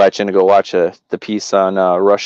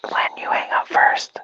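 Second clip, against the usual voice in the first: whispering; 7 dB quieter than usual. Real, not acted. Playful hang-up-first banter.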